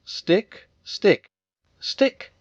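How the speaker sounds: eager little shouts at the river; calling out. A narrator's reading. Roo urging his stick on from the bridge, calling out. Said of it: "calling out".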